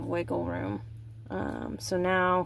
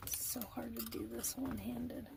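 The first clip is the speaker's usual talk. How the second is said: whispering; 17 dB quieter than usual. Real, not acted. Straining, muttering.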